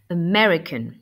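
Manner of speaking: "American" with MER punched, the first A a weak "uh"; stress on second syllable. Shown as a demonstration. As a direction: model "American", leaning hard on the second syllable. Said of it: "on insiste bien sur la deuxième syllabe". (« American » accentué sur la deuxième syllabe, premier A réduit en « e »)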